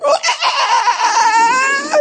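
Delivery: long high-pitched bawling cry; re-enacted overwhelmed wail; emotional wail. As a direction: let out a drawn-out mock sob. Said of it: "It was unbelievable emotional".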